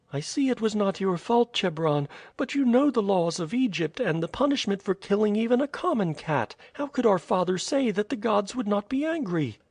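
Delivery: put-on high girlish voice, reproachful and puzzled; a worried young girl questioning a priest; lightly voiced girl, anxious; young girl Mysa.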